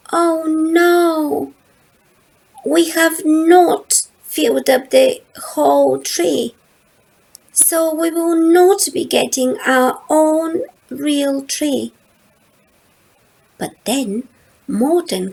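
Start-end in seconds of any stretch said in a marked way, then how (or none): 0.1-11.9 1 disappointed Lola. A narrator's reading. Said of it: "Lola says".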